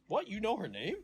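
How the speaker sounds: high-pitched mock disbelief; retold surprise. Re-enacts manager's surprised question.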